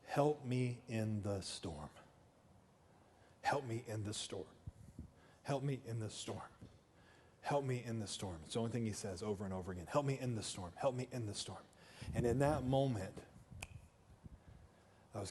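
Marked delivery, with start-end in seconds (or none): 0.0-8.4 low, hushed repeated plea; quiet prayer. Retold or imitated. Five-year-old praying through a tornado, retold as a quiet prayer. Retelling a child praying in storm. 9.9-11.6 low, hushed repeated plea; quiet prayer. Retold or imitated. Five-year-old praying through a tornado, retold as a quiet prayer. Retelling a child praying in storm.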